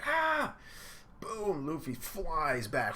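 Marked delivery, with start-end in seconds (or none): 0.0-0.5 sudden very high shriek. Retold or imitated. Nami seeing Luffy get kicked, high shriek. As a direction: shriek in alarm. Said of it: "She's like".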